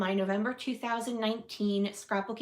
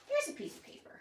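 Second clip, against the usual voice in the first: high pet voice; 7 dB quieter, much faster than usual. Real, not acted. Offering paper to bird.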